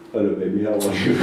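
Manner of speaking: put-on low, dull hello to a baby; low monotone greeting. Shown as a demonstration. Coach showing how not to greet a baby, low monotone. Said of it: "you don't walk up to the baby and go".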